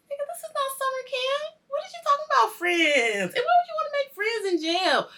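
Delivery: high-pitched, indignant disbelief; incredulous. Real, not acted. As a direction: question it like it is absurd. Mock disbelief at old man's advice.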